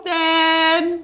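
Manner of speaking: loud call across the house. Real, not acted. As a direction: shout for Justin to come help. Calls Justin to help.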